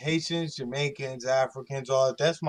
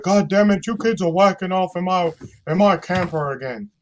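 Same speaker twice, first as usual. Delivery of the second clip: louder, mock exasperated cartoon dad; Hank Hill impression; 4 semitones higher, 7 dB louder than usual. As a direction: bellow at the kids like a fed-up cartoon dad. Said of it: "the guy is Mike Judge for coming up with".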